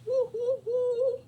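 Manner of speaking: high, loud squeal of joy; high-pitched whoops; excited woo. Retold or imitated. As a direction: cheer like a kid. Cheering about school lunch.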